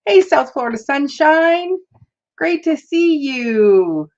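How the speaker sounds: high, slow drawn-out welcome; real happy hello, pitched high; excited greeting. Real, not acted. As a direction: welcome a friend warmly and brightly. Greeting viewer by handle.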